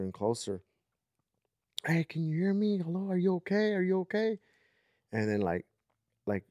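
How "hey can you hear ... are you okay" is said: high, raised calls asking if she's okay; calling out. Retold or imitated. Getting closer, calling to the crying girl. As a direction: reach out to a scared child.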